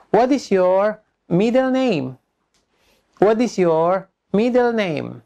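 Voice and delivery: slow question broken in two chunks; measured drill delivery, pausing midway; repeat-after-me model. Shown as a demonstration. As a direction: model the question carefully for learners. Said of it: "Anda ulang sesudah saya".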